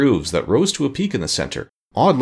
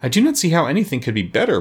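mild protest, pitched higher; higher-pitched, mildly aggrieved objection; 6 semitones higher than usual. A narrator's reading. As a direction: protest it a little plaintively. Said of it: "protested poor Jack".

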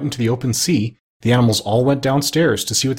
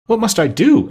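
high, louder worried pirate; a hint of a nervous pirate chief; pirate asking; 10 semitones higher, 5 dB louder than usual. A narrator's reading. Pirate asks the doctor what he must do, high and anxious.

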